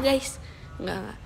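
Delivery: ordinary delivery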